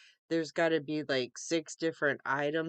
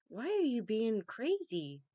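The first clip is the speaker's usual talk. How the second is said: talking to the cat; 5 semitones higher than usual. Real, not acted.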